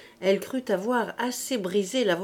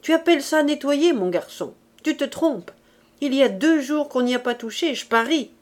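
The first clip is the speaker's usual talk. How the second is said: higher, louder maternal reproach; scolding mother; 6 semitones higher, 5 dB louder than usual. A narrator's reading. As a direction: tell the boy off sharply. Mother scolds her son. (mère qui gronde)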